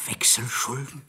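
startled whispered question. Acted. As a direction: breathe the word out in alarm. Startled hushed echo of debts.

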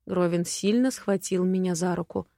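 ordinary delivery, nothing marked

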